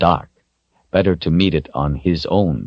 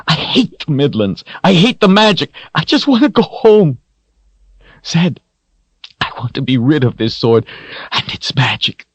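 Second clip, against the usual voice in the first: higher, louder tearful cries; sobbing lament; 10 semitones higher, 3 dB louder than usual. A narrator's reading. Richard sobs against Zed, pouring out his misery. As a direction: wail out the despair between sobs. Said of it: "let him cry".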